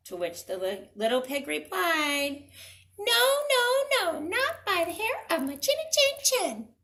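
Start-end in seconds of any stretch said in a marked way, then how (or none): 3.0-6.7 squeaky pig refusal. Acted. Pig refusing the wolf, very high squeal. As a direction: squeal the refusal for the kids to join.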